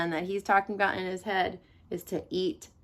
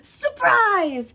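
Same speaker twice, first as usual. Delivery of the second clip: loud high cry of Surprise; gleeful shout; 11 semitones higher, 8 dB louder than usual. A narrator's reading. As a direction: burst in with a shout. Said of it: "cried the wolf".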